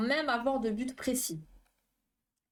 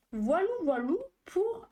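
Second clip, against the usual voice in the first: playful sing-song; 9 semitones higher than usual. Real, not acted. Playful sign-off ending the facts.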